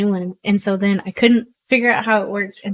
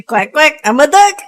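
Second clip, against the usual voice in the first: high, loud goofy quacking; silly duck line; 5 semitones higher, 9 dB louder than usual. Retold or imitated. Her old voicemail ending, loud silly quacks. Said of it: "And then I say".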